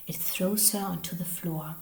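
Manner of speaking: ordinary delivery, nothing marked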